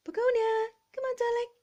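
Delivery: sing-song call to coax the cat. Real, not acted. Calling her cat.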